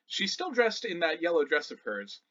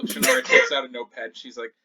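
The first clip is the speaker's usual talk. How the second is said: normal talking voice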